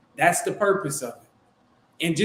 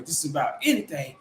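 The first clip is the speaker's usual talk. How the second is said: ordinary talk, nothing marked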